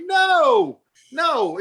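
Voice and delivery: high, drawn-out protesting no; emphatic refusal. Retold or imitated. Refusing the pressing recruiters.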